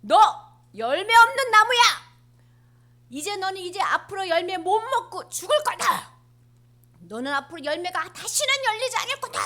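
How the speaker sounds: loud, high furious curse at the tree; angry rebuke. Acted. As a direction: scold the barren tree. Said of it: "화를 확 내시는 거야".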